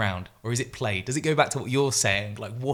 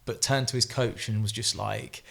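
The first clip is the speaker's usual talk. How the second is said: usual speaking voice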